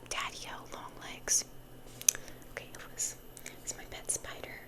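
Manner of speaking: ordinary talk, nothing marked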